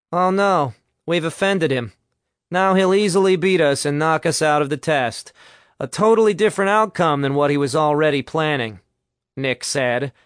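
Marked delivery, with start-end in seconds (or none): 0.1-8.8 mock dismay. Nick mocks Chad for being offended, sarcastically. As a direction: feign horror, then drop it dryly.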